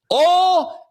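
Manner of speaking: high country "oil" flattened to "awl"; loud, high-pitched country "awl" for "oil"; country pronunciation. Retold or imitated. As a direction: mimic a country man's "oil" as one long "awl". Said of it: "His enunciation was".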